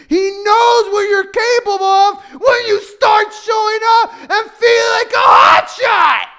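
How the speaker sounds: building to a high, loud shout; impassioned, escalating pulpit shout; preaching at full force. Real, not acted. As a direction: preach it up to a roaring climax.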